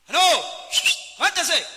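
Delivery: mildly angry, brusque refusal; enojado. Acted. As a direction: play an irritated man just woken up. (un poco enojado, cortante)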